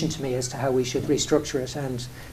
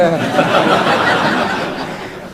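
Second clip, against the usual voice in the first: laugh; 3 semitones higher, 4 dB louder than usual. Real, not acted. Laughing at own retort.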